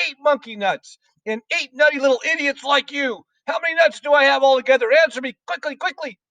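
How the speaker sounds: very high, strident headmistress rant; shouted tirade. A narrator's reading. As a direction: berate the pupil at a high, furious pitch. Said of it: "shouted the Trunchbull".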